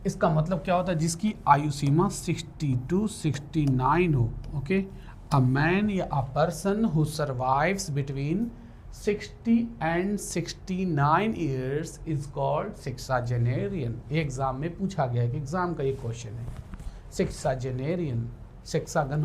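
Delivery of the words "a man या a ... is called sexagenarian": slow dictation. Shown as a demonstration. Teacher dictating a definition.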